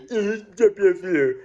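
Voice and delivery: mock cop voice. Cop drops him off.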